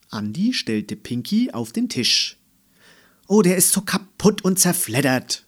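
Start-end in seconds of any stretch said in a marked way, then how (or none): 3.3-5.5 loud, high-pitched complaint; scolding. A narrator's reading. Mama disgusted by the tattered backpack, scolding. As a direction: scold in exasperation. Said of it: "schimpfte Mama".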